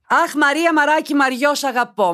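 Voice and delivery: high-pitched, loud gushing; over-the-top adoring outburst; gushing affection. Acted. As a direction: coo the pet names way up high. Gushes over the letter writer.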